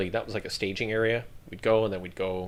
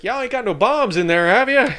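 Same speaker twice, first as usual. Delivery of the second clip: much higher, louder teasing Texan question; Texan drawl; 13 semitones higher, 9 dB louder than usual. Retold or imitated. Texan handler teases the soldier, loud and high in a Texan accent. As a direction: rib him like a joking Texan. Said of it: "He's like".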